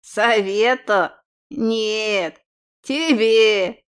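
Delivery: put-on old hag baring teeth; snarling old crone. A narrator's reading. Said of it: "оскалилась старуха".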